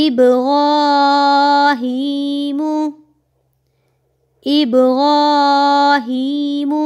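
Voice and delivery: slow, melodic tajweed chant; teacher reciting verse slowly, melodic chant; Quran recitation. Shown as a demonstration. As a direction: draw out each vowel in recitation.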